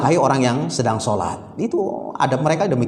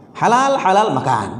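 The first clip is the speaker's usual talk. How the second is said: mock seller call; 6 semitones higher than usual. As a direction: sing it out like a market vendor.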